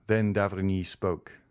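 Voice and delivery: ordinary delivery, nothing marked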